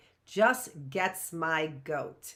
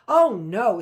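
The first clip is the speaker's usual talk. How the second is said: flustered; 3 semitones higher, 12 dB louder than usual. Acted. Mister Willow flustered.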